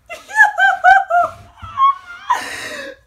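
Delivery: very high, loud squealing laugh; shrieking laugh. Real, not acted. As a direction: lose it with joy. Callum offers a high five.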